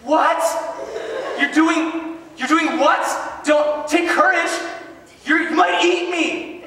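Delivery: high, loud disbelief with a laugh; incredulous. Acted. Disciples doubting Jesus on the water, high incredulous shrieking. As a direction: squeak it in total disbelief.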